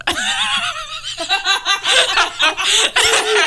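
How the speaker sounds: long high laugh; long embarrassed laugh. Real, not acted. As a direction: crack up at your own blunder. Embarrassed at own gif mistake.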